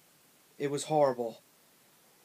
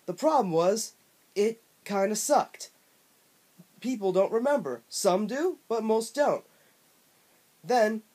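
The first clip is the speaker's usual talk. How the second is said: smug, brash and pitched way up; obnoxious Bubsy voice; 3 semitones higher than usual. Acted. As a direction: ham up an obnoxious cartoon cat. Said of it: "had the most obnoxious voice I've ever heard".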